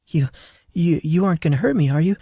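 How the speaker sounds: high, fast, stammering with fear; a nurse pinned to the wall, stammering fast and high in fear; frightened stammer. Acted. Nurse pinned to the wall begs him, high frightened stammer. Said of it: "her voice quivered with fear".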